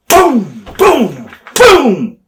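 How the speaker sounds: three high, loud punching booms; imitated punches. Storyteller acting out Orndorff punching Vader, loud high booms. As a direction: hammer out each boom like a blow landing.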